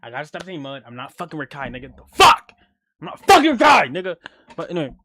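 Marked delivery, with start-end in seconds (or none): 2.0-2.5 sudden high, loud "The fuck?"; high yelled exclamation; loud outburst. Real, not acted. Outburst before yelled repeat. 3.0-4.2 high, loud yell of "I'm not fucking Rakai"; the same line yelled much higher and louder. Real, not acted. Repeats line yelling.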